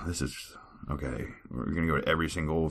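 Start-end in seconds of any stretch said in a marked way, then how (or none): none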